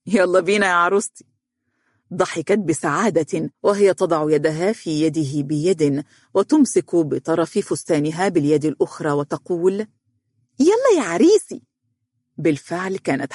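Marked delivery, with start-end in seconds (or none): 0.1-1.3 put-on playful childish voice, lightly done; childlike voice. A narrator's reading. Man playing groom to a little girl, mildly childlike. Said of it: "قائلًا بصوت طفولي". 10.6-11.6 put-on very high, gleeful child voice; happy little girl. A narrator's reading. Little girl playing bride to her groom, high and gleeful.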